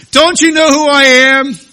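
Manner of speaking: full-volume, high-pitched indignant yell; outraged shout. Acted. Parent to a child who disobeyed on purpose, outraged shout. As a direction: bellow it like an authority openly defied.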